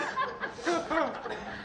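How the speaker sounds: quick high breathy laugh; laughing at the Eeyore joke. Real, not acted. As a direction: crack up at your own line.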